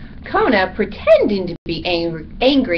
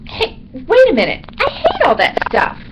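high, loud bat outburst; indignant high-pitched bat; sudden realization; 10 semitones higher, 5 dB louder than usual. A narrator's reading. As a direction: break off, struck by a new thought. Bat suddenly realises he hates food.

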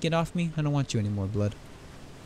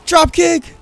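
high, loud "drop kick!" yell; battle cry; 12 semitones higher, 11 dB louder than usual. Real, not acted. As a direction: call the move out like a wrestler. Announcing his kick.

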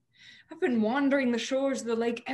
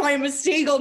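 loud, high, distraught; 5 semitones higher, 10 dB louder, faster than usual. Acted. Frantic repeat of seagull.

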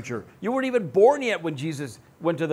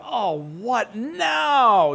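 slow, drawn-out fed-up sigh; exasperated groan; 5 semitones higher, 5 dB louder, much slower than usual. Pretend. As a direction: sound worn down by one more problem. Fed up with piling troubles.

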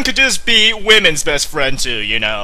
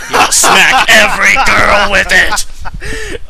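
mock battle cry; slower than usual. Mock heroic proclamation.

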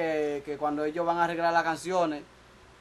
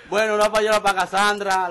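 louder, higher shout-out call; hyped announcement; 4 semitones higher, 7 dB louder than usual. Acted. Shouting a shout-out to Cassandra, louder and higher. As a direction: announce the name like a DJ.

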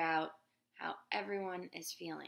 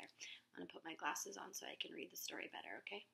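whispered aside; 8 dB quieter, faster than usual. Real, not acted. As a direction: whisper it privately.

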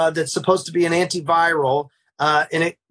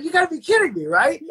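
much higher, mock-incredulous plea; incredulous protest; 10 semitones higher, faster than usual. Smoker to cop on being arrested, high incredulous protest. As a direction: act out disbelief, pitched right up.

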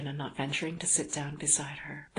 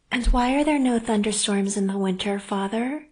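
put-on higher, louder child's voice; young daughter; 5 semitones higher, 8 dB louder than usual. A narrator's reading. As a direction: ask father like a curious child. Daughter questions her father.

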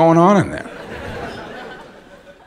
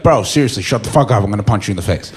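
lower, flat menace; low, fed-up threat; 8 semitones lower, much faster than usual. Retold or imitated. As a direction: threaten him calmly. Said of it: "he was like".

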